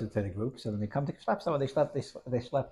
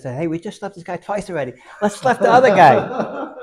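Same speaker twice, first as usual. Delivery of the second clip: laughing while quoting; 8 semitones higher, 14 dB louder than usual. Acted. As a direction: crack up mid-quote at the punchline. Dancer proposes new target, laughing.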